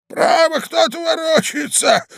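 put-on very high, loud old man; slow, high-pitched old husband. A narrator's reading. Old man telling his wife someone is moving, high and loud. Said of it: "сказал старик".

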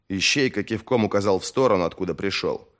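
ordinary delivery, nothing marked

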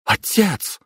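high sharp cry of reproach; exclaiming. Acted. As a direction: call him out, shocked. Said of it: "воскликнула мама". (возмущённый возглас)

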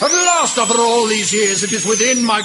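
gloating triumph. Acted. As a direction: crow it out in triumph. Second take much higher and louder.

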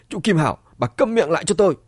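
loud, high-pitched order to shut up; angry yell. A narrator's reading. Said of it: "giận dữ hét lên". (giận dữ quát lên)

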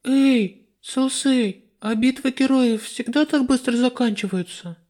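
put-on high, curious girl voice; young goddess voice. A narrator's reading. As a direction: ask lightly like a curious girl. Lista asks Celsey.

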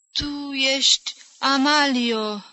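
a hint of someone staring into emptiness; dreamy old woman. A narrator's reading. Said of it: "șopti Iaca privind în gol".

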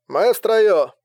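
loud, high call through a comm earpiece; crackly vox report from the cockpit; pilot over radio. A narrator's reading. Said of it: "произнёс голосом пилота вокс-коммуникатор".

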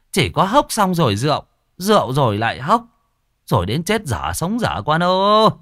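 high, loud scolding mother's voice; a mother yelling at her lazy son; mother scolds her drunk son, loud and high; nagging mother calling. Acted. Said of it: "ngó vào trong buồng gọi".

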